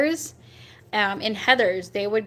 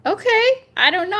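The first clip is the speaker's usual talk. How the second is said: surprised; 10 semitones higher, 5 dB louder than usual. Real, not acted. Reacting to peanut butter hamburger.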